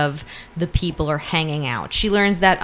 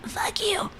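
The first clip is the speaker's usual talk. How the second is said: raspy whisper; 8 dB quieter than usual. Retold or imitated. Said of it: "Briar's like".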